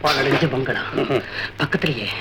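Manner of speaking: low, halting, ominous murmur; spooky storytelling. Acted. Son describing ruined bungalow to father, spooky and hushed. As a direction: set the haunted scene in a hushed voice.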